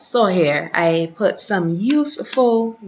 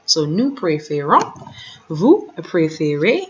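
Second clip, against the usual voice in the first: ordinary talk, nothing marked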